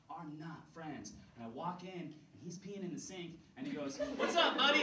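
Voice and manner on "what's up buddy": high, louder buddy greeting; mock-friendly bully. School bully greeting Kurt at the sink, high and chummy. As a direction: greet him loudly in a high pitch.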